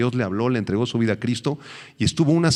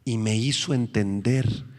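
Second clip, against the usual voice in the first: usual speaking voice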